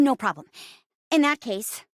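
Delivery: ordinary delivery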